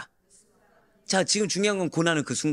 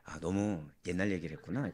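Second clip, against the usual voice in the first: ordinary talk, nothing marked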